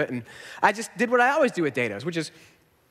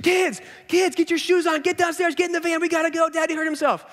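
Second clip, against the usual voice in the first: high urgent mom calling up; calling up the stairs; 9 semitones higher than usual. Retold or imitated. Wife calls sleeping kids downstairs, urgent high call. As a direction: hurry the kids down, pitched up.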